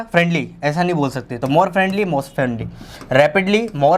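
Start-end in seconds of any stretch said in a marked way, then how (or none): 0.0-0.5 friendly run together quickly; fast, unsplit friendly; all in one go. Shown as a demonstration. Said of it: "एक बार में".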